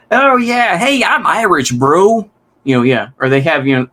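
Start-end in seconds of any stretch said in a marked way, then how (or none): 0.1-2.3 high, goofy put-on voice of a clueless guy; mock wannabe Irishman. Streamer imagining an American claiming to be Irish, goofy mock voice. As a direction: ham up an eager fake Irishman. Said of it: "imagine some guy who says".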